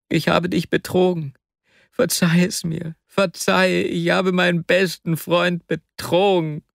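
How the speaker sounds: high, anguished pleading; Wasja, begging forgiveness. Acted. Wasja begs his best friend's forgiveness, distraught.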